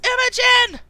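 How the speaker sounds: high, loud alarmed shout; Dwight shouting a warning. Acted. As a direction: yell her name in alarm. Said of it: "And Dwight shouts".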